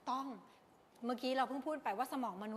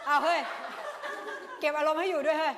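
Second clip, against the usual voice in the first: laughing exclaim; 3 semitones higher, 7 dB louder than usual. Real, not acted.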